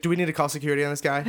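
imagined bystander alarm. Retold or imitated. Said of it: "Are you like".

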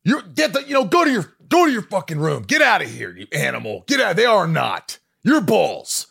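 high, loud, furious yelling; a father blowing up at his son, mimicked at full volume; furious dad yell. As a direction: scream at the kid to get out of the room. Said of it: "He blew up".

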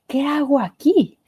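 whispered, slow, choked with doubt; tearful whisper. Retold or imitated. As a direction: susurra como escondida llorando en el baño. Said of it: "me metí al baño a llorar". (susurro lloroso y lento, casi sin voz)

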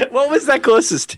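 high-pitched, through real laughter; laughing question. Real, not acted. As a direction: ask it while still cracking up. Asking while still laughing.

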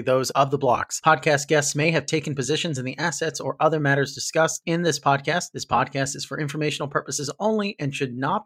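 quick, even legal disclaimer; disclaimer read. Acted. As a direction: read it like fine print.

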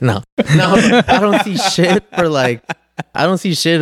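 high-pitched, laughing protest; laughing denial. Real, not acted. Guest corrects his own joke to the hosts, laughing.

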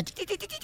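tiny high, soft chirped steps; little flea footsteps. Acted. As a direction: imitate a tiny flea pattering along, high and quiet. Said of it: "Y entonces camina".

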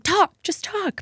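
exasperated. Retold or imitated. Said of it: "Where it's just like".

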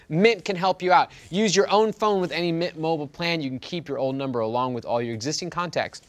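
ad read. Acted. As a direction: read the ad copy smoothly. Sponsor ad read.

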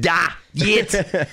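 emphatic mock Russian accent; playful Russian accent, punched out. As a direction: bark it out like a cartoon Russian. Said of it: "That's my Russian accent".

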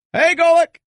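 very high, loud shouted greeting; Greenie impression. Radio host greeting his co-host in a squeaky high shout. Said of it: "I can let a little greenie".

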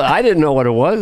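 ordinary talk, nothing marked

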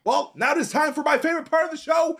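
big high announcer voice; segment announcer. Acted. Announcing favorite show segment.

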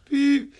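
high, loud mimicked electronic beep; imitated card beep. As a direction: chirp it like a card reader accepting a swipe. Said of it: "he pulls out another and he goes".

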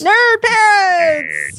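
loud slow laughing NERD parents; stress on nerd. Acted. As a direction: belt out "Nerd" while laughing. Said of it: "I like how you emphasize nerd".

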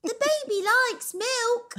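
very high, hammed-up British accent; best British accent. Shown as a demonstration. Guest shows off for her laughing host in a high, hammed-up British accent. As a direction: squeal it in an exaggerated British accent. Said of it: "that was my best British accent".

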